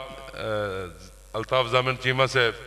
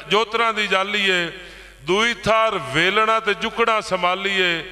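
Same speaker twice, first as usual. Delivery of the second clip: poetry recital; 7 semitones higher than usual. Acted. Poet lists plough parts to farmers, declaimed verse. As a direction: chime each rhyme in steady metre.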